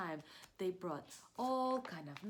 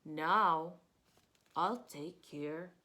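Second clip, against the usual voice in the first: slow, soothing bear voice; tender bear; 4 semitones lower, 4 dB louder, slower than usual. Acted. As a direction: Bear comforting his sick friends, slowly. Bear comforts sick friends.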